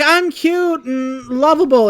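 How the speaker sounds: slow, high mock-cute little girl. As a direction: play the adorable niece, slowly and sweetly. Voicing adorable little girl.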